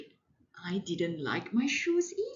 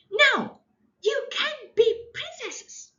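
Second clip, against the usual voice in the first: put-on high, loud bossy child; bossy shout; 7 dB louder than usual. Child bossing her friend in a sulk. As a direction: order it like a sulky little girl. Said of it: "And I kept saying".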